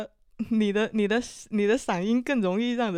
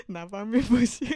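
laughing; 3 semitones higher than usual. Real, not acted. Teasing laugh at self-deprecation.

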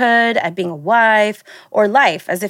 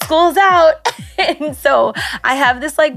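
laughing; 7 semitones higher, slower than usual. Real, not acted. Laughing about school being out.

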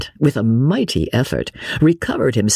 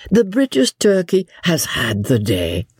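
higher-pitched mocking tease; mock him lightly, pitched up; 8 semitones higher than usual. A narrator's reading. Wife teasing her husband before guests, mocking. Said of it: "laughed a mocking little laugh".

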